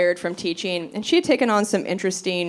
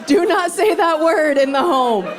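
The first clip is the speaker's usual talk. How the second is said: higher, louder panicked no's; frantic protest; 7 semitones higher, 3 dB louder than usual. Retold or imitated. Cousins stopping mom mid-sentence, panicked loud protest. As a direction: shut it down fast, half laughing. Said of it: "my cousin and I were like".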